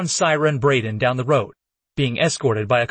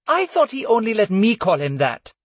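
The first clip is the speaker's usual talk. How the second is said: Syra's voice; 8 semitones higher than usual. A narrator's reading. Syra voiced, higher.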